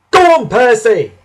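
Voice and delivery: high, loud yell of encouragement. A narrator's reading. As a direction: cheer the engine on at full voice. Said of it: "yelled his driver".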